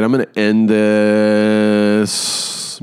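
slow, stretched final word; drawn-out sign-off. Real, not acted. Host wrapping up the episode, drawn out. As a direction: drag it out at half speed.

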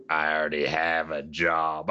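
low, slow menacing drawl; Cad Bane. Retold or imitated. Host quotes Cad Bane's threat, slow and menacing. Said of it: "he's far away and menacing".

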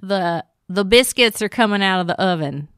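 bad Southern accent. Shown as a demonstration. Co-host dares her to try, amateur Southern accent. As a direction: have a shaky go at a Southern accent. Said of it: "I'll show you how bad I can do it".

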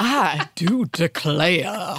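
slow, drawn-out cartoon Southern belle; hammed-up Southern drawl, stretching every word; cartoonish Southern accent. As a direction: put on a silly Southern accent. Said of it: "What I mean is the cartoonish Southern accent".

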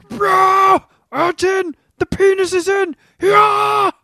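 high loud mock yell; mock porn shout. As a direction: bellow like a hammed-up porn actor. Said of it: "Euro porn guys".

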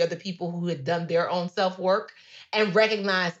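ordinary talk

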